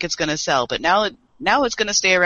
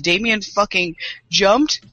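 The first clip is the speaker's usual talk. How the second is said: ordinary talk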